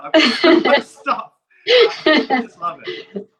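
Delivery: loud, laughing fan gushing; excited gushing. Real, not acted. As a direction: gush about the collection, laughing. Excited fan gushing over collection.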